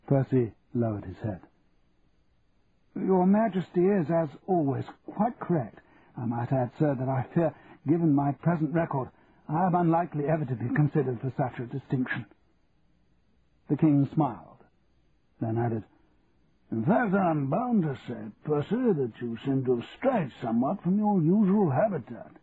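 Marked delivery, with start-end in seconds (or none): 2.9-12.3 higher, humbled aristocrat; upper-class Percy, deferential. Acted. Percy bowing to the King's correction, humbly deferential. 16.7-22.3 deliberate royal observation, lightly amused; the King, drily teasing. Acted. The King remarking on Percy among graduates, drily regal.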